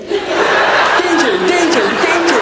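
high-pitched comic danger warning; mock alarm. Comic warns off someone who asked how he is, mock alarm. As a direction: sound a silly high alarm, word after word.

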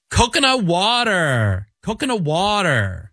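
lightly imitated kid sing-song; daughter's meme line. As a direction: mimic the daughter doing the meme. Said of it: "She goes".

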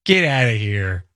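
slow, drawn-out mock Philadelphia vowels; put-on Philly accent. Host answering co-host's disbelief in a slow Philly accent. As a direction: stretch it out like a Philly local. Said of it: "can you do a Philly accent?".

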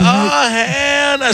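high, slow drawn-out hell no; outraged exclamation. Retold or imitated. Retelling his reaction to the kid, outraged oh hell no. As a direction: stretch it in mock outrage. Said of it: "I was like".

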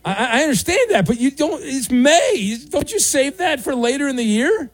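high, disbelieving questioning; incredulous. Real, not acted. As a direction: scold him in high disbelief. Host incredulous at caller.

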